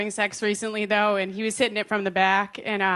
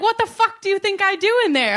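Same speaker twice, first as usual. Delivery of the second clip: put-on loud, high Boston accent; 9 semitones higher, 4 dB louder than usual. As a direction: snap back in thick Boston accent. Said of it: "I was like".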